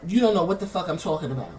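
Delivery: ordinary delivery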